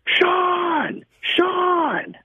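high shouted call of a name; hollering from across a busy avenue; yelling across the street. Retold or imitated. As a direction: stranger shouting Sean across Fifth Avenue.

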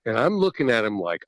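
ordinary talk, nothing marked

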